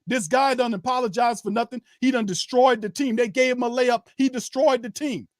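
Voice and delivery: ordinary talk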